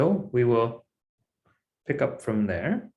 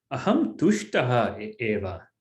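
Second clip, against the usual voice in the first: ordinary talk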